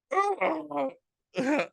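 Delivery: very high retold sobs; mock crying. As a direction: wail in exaggerated, high-pitched sobs. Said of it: "I'm crying".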